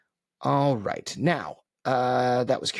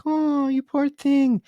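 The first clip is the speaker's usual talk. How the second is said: put-on high, slow, cooing baby talk, much louder; 5 dB louder, slower than usual. Parent putting on a high, slow babying coo. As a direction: coo it like soothing a toddler. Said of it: "like, babying tone".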